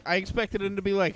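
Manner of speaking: normal talking voice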